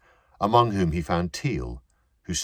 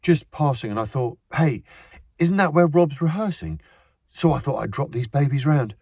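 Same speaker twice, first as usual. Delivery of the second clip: forced breeziness; 10 semitones higher, 5 dB louder than usual. A narrator's reading. Edward's forced breezy cover.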